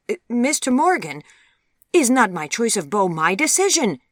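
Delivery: high, loud, incredulous outburst; storming. A narrator's reading. Daughter protesting her mother's contradictory orders, storming. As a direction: storm back in exasperated protest. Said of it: "stormed Vivian".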